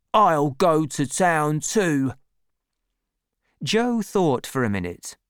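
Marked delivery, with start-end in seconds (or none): 0.1-2.1 slow, higher stubborn reply; insist, a bit defiant; Orlick defiant. A narrator's reading. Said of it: "said Orlick".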